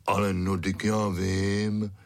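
slow, drawn-out growly dog; growling. A narrator's reading. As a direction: grumble it like a big dog. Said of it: "zavrčel Fík".

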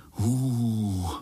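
long drawn-out awed hoot; hooting "hoo". A narrator's reading. Spiderlings react to the avenging monster, hooting. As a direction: hum out one long "hoo". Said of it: "zahučeli pavoučci sborem".

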